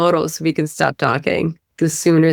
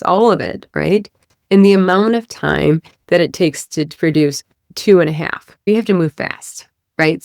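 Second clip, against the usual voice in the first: usual speaking voice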